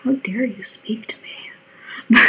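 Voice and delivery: slow, voiceless whisper of outrage; mock affronted whisper. Imagined reply to the nosy stranger, affronted whisper. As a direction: whisper it as if mortally offended.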